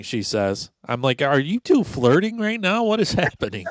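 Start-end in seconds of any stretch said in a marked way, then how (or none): 1.2-3.7 high-pitched incredulous questioning; retold disbelief, starting high and easing down; mock bewilderment. Reacting to two people flirting, mock bewildered.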